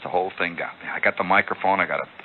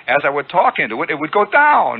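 rising voice demo; 7 semitones higher, 4 dB louder than usual. Shown as a demonstration. Radio host explaining downward modulation, voice climbing high. Shows his voice driving the signal.

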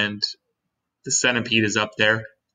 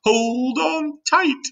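very high, stretched-out warning call; excited grasshopper shout; much slower than usual. A narrator's reading. Old green grasshopper as the floor heaves, excited high call. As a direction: sing out a slow, high warning. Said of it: "shouted the old green grasshopper".

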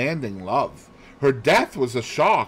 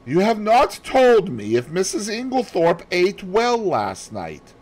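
lightly higher question; Poirot voice; 10 semitones higher, 4 dB louder than usual. Acted. Poirot asks Hastings about Mrs Inglethorpe's supper, lightly higher. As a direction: drop the odd question casually.

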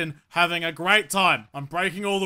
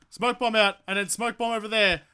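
ordinary delivery

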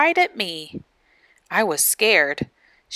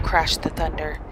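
ordinary delivery, nothing marked

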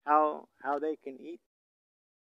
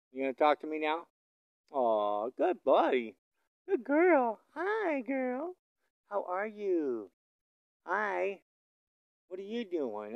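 higher, sing-song voice for the cows; pet talk; 6 semitones higher, slower than usual. Real, not acted. Rancher chatting to cows and calves, higher sing-song. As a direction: coo at them like pets.

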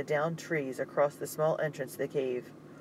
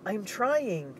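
higher, effortful shout back; strained reply; 7 semitones higher than usual. Acted. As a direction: answer mid-effort. Straining against the barricade.

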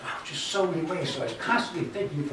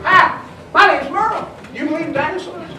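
put-on high, eager kid voice; little voice; 13 semitones higher, 9 dB louder than usual. Merle spreading the dinosaur news, high and eager. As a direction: chirp it like a small excited boy. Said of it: "His little voice going".

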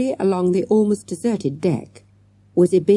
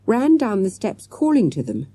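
usual speaking voice

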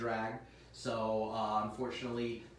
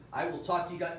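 ordinary delivery

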